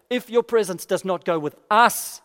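insistent plea, punching the word "us"; stress on US. Acted. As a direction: build to a loud, emphatic "us". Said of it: "you hear the emphasis on us?".

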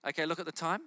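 ordinary talk, nothing marked

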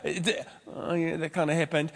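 soft, reluctant admission; playing the caught member, quiet and sheepish; sheepish mumble. Sheepish reply of confronted member.

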